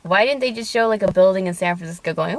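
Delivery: normal talking voice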